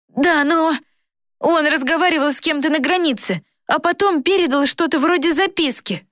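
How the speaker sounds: put-on high boyish voice, insisting; urgent boy. A narrator's reading. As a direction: report it eagerly in a high boy's voice. Excited boy voiced in story. (высокий настойчивый голос мальчишки)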